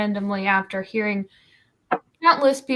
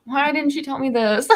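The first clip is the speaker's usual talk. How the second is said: higher, laughing mock wail; mock complaint; 5 semitones higher than usual. Streamer voicing everyone watching today's stream, mock-complaining through laughter. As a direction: playfully protest as if cheated of a tip.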